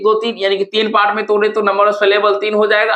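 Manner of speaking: ordinary delivery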